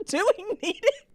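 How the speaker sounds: high-pitched mock wimp, on the edge of laughter; wimpy self. Would-be mom doubting she'd save the baby, mock wimp. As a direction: squeak it out as your cowardly side. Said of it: "the wimp in me".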